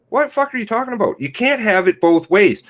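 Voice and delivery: fast, high, incredulous outburst; exasperated outburst. Real, not acted. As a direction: fire it off in disbelief.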